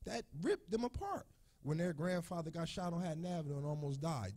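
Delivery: holding back crying as he lists the losses; choked grief, voice climbing; emotional, voice breaking. Real, not acted. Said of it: "It's making me emotional".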